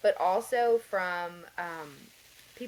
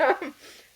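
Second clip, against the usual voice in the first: short hummed laugh; 8 semitones higher, 8 dB louder than usual. Real, not acted. Embarrassed laugh.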